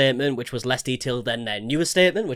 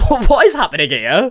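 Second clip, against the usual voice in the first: loud, high, laughing disbelief; incredulous; 5 semitones higher, 9 dB louder than usual. Real, not acted. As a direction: blurt it out in amazement. Shocked by donor.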